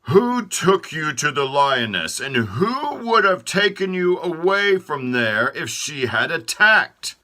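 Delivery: proclaiming. Acted. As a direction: Feet bragging to the other body parts. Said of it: "Feet proclaimed".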